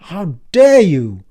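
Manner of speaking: loud, slow offended demand; outraged. Acted. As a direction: stress DARE in outrage. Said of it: "stop yelling".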